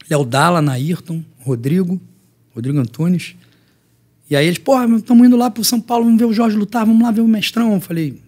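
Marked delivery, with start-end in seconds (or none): 4.6-7.7 voiced high and louder, pumped up; excited friends. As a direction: hype him up like eager buddies. Friends calling him excitedly. (amigos empolgados chamando)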